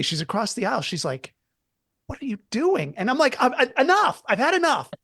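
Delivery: ordinary talk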